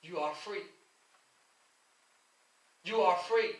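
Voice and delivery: usual speaking voice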